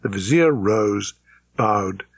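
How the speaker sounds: plain reading voice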